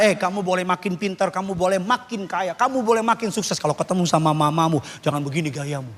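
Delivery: ordinary delivery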